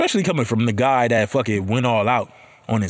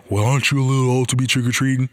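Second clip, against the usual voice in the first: snooty neighbor; faster than usual. Retold or imitated.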